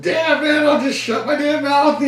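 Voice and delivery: high squeaky grumble; sulky kid. Retold or imitated. Scolded kid grumbling to himself, high mock voice. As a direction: mutter like a cowed child.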